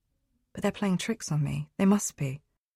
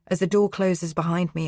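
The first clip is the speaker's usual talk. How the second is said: plain reading voice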